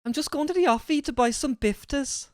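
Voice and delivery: high mock-Scouse voice; exaggerated high Scouse imitation; Scouse accent. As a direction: copy a Scouser, pitched right up. Echoing a Scouse example phrase.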